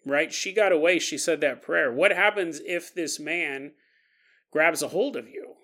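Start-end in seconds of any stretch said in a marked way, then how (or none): none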